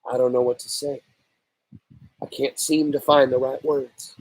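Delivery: quietly lost for words; mother murmuring, at a loss before her son; low voice. Acted. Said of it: "in a low voice".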